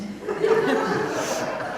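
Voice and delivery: breathy high giggle; high laugh. Real, not acted. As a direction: laugh before reading the list. Laughing at wish list.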